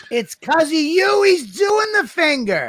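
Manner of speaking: high, laughing mock outrage; squealing with laughter; crying laugh. Real, not acted. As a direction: accuse him while cracking up. Mock blaming friend.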